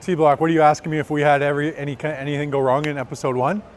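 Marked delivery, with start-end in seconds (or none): none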